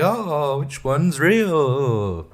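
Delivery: very slow, drawn-out mock wonder; puzzled wondering. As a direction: stretch it out like an intrigued viewer. Acts out a puzzled viewer.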